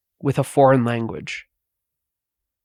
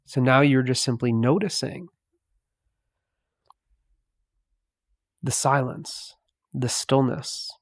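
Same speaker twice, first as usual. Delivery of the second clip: guided meditation. Acted. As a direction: ease the listener into the silence, slowly. Slow soft meditative guidance.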